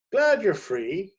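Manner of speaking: high, loud, slow and hearty; breezy editor. A narrator's reading. Editor to the confused critic, loud and breezy. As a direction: toss it off cheerfully. Barnaby dismissive.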